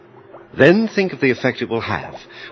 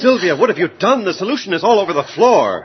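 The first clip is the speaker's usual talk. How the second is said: higher, pressing reproach; exasperated scolding; 8 semitones higher, 4 dB louder than usual. Acted. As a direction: scold her for the spill. Doctor scolds spilled solution.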